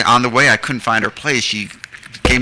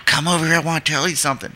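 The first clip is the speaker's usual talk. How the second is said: Mamie; 6 semitones higher, faster than usual. Retold or imitated. Imitates Mamie beckoning from pickup.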